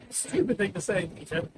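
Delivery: usual speaking voice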